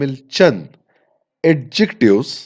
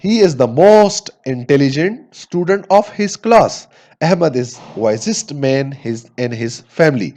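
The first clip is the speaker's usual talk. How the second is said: slow example reading; much slower than usual. Shown as a demonstration. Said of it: "मिसाल के तौर पर".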